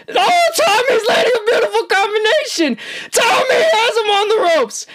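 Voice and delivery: high, real cheering yell; excited shout. Real, not acted. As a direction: shout the combination out, thrilled. Live fight commentary, shouting.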